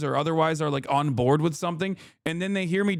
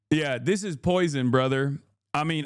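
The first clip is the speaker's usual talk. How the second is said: normal talking voice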